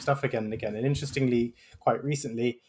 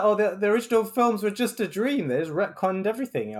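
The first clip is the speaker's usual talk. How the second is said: 1 mocking; 5 semitones higher than usual. Mocking Matrix writers.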